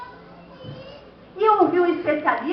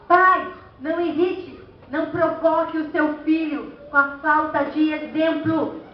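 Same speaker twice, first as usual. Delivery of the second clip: ordinary talk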